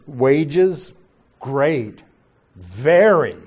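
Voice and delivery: one word at a time, long pauses between; emphatic single words, each standing alone; literal word order. Shown as a demonstration. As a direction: isolate "Wages", "great", "very". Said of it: "It says".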